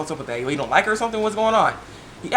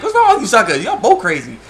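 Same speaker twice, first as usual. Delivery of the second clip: incredulous yell; 7 semitones higher, 5 dB louder than usual. Retold or imitated. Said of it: "I'm like".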